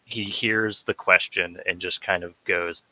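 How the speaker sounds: ordinary talk, nothing marked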